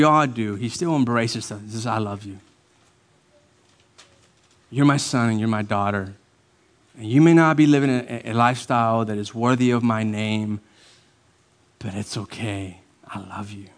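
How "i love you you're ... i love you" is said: lower, softening to a hush; a father gently reassuring his child; tender reassurance. Acted. Pastor voicing God accepting a failing child, tender reassurance.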